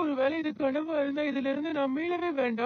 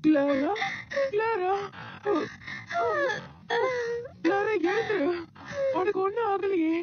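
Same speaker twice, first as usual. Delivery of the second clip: tearful excited calling; 6 semitones higher than usual. Acted. Grandmother calling Clara, stunned and tearful with joy. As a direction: call out to her, half crying with joy.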